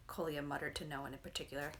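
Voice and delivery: ordinary delivery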